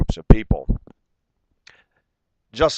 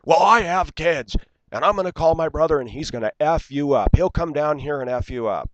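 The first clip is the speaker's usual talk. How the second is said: imitating her threats in a raised, higher voice; higher-pitched mock of the woman threatening him; mock angry woman; 7 semitones higher than usual.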